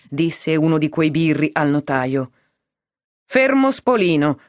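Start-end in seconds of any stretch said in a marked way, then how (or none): 3.3-4.4 higher-pitched, mildly warning use of a name; menacing advice. A narrator's reading. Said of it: "con aria di consiglio minaccioso". (tono di consiglio velatamente minaccioso)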